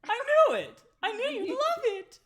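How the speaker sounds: very high, very loud cartoon squeak; Mickey Mouse-like squeal at the guest. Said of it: "You sound like Mickey Mouse".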